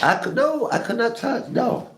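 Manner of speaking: high, firm no; emphatic refusal. Real, not acted. Emphatic refusal to touch body.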